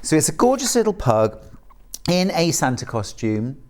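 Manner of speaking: ordinary talk, nothing marked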